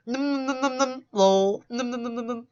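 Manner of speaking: sing-song the many syllables; mock syllables. Shown as a demonstration. Showing how he reads the chat, a high num-num chant. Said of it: "That's my version of many syllables".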